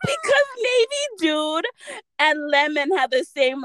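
high, squeaky laughing words; laughing explanation. Real, not acted. Friend explaining the name mix-up, laughing and high-pitched. Cracking up at story's punchline.

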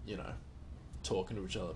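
ordinary talk

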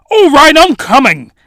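hammed-up fake British accent; terrible British accent. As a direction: do a bad British guest apologizing. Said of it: "terrible British accent".

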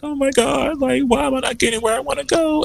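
high, mock-despairing wail; high-pitched mock lament. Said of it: "I was crying. I was just like".

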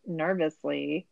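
usual speaking voice

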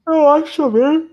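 talking through a yawn. Real, not acted. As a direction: yawn while saying it. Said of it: "Ó, tô bocejando".

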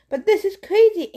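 high, loud protest; cried out. A narrator's reading. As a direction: burst out in disbelief. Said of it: "Anna cried".

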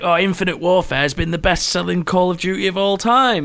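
high, smug mock announcement; mock the company's boast; mocking corporate boast. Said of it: "they were like".

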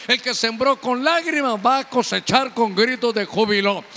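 loud, driving sermon delivery; full-force preaching. Real, not acted. Preacher promising a grieving church joy after tears, forceful proclamation. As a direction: preach it out strongly, building to a climax.

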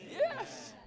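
sudden high burst of delight; excited exclamation. Real, not acted. Preacher exclaiming to the saints over the verse, thrilled. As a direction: leap up in joy at the verse.

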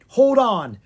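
loud, high call; urgent shout. A narrator's reading. Bard calls to the frightened prince, shouting. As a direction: shout to make him wait. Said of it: "shouted Will".

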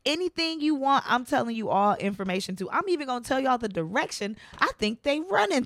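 high-pitched mock snitch spilling it all; eager informant. Co-host voices the man who starts singing instantly, mock eager. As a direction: imitate someone gushing to cooperate.

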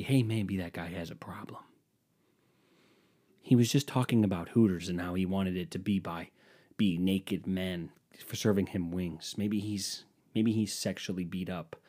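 hushed aside, like a producer murmuring off-mic; whispered aside. Pretend. Imagined producer whispering to the audience, hushed. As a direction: keep it low and breathy, as if leaning to the audience.

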